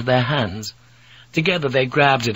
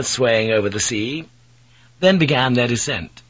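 plain reading voice

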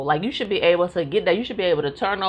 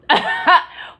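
high laugh; 11 semitones higher, 5 dB louder than usual. Real, not acted. Laughing at own reaction to God.

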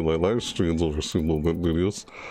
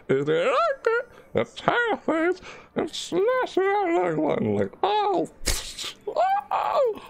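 much higher, playful falsetto; high silly voice; slower than usual. Pretend. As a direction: squeak it out teasingly. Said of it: "slap that naughty like".

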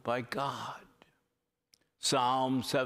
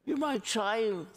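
higher, tender voice of God; God speaking tenderly; 9 semitones higher than usual. Acted. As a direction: speak as a loving father to his child. Preacher voices God to listener.

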